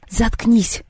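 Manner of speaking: sharp high snap; curt shut-up. A narrator's reading. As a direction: slam it down rudely. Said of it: "невежливо бросила". (Лёля резко обрывает Машу)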